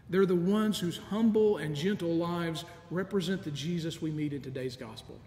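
ordinary talk, nothing marked

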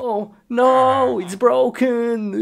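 high-pitched wail of dismay; mock dismay. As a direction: lament it high and drawn out. Acts dismay at broken computer.

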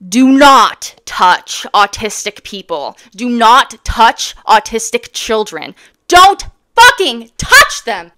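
slow, stressed, building to a furious shout; angry warning. Real, not acted. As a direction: hammer each word, then explode. Angry warning about touching.

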